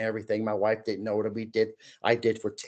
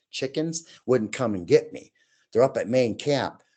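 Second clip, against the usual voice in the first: usual speaking voice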